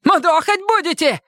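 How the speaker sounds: very high, agitated challenge; fly off the handle in a thin squeal; flaring up. A narrator's reading. Detainee accusing police of planning beating, high agitated flare-up. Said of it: "снова взвился Лысый".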